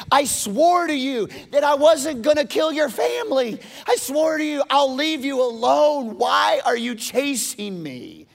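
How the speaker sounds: high, loud, aggrieved cry; indignant protest. Acted. David confronting Saul who hunts him, indignant shouting. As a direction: demand an answer at the top of the voice.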